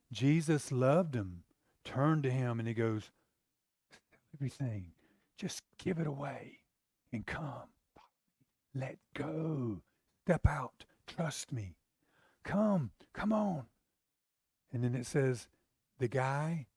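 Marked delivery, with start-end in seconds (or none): 3.9-13.7 soft, gentle pleading; tender pleading. Retold or imitated. Jesus pleading softly with the rich young ruler. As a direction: coax him softly to let go. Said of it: "he's pleading with him".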